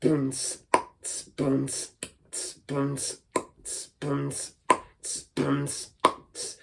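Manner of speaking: soft boom tss pattern; quiet beatbox loop; beatbox groove. Shown as a demonstration. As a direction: lay down a mouth-drum beat. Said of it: "so it can be".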